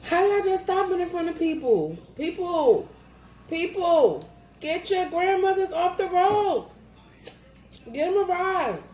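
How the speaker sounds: high, loud exasperated rant; road rage rant. Real, not acted. Ranting about stopped drivers, high and loud. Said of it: "I got road rage".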